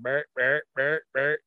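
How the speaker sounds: imitating a deer's grunts; deer grunt imitation. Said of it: "He must have grunted".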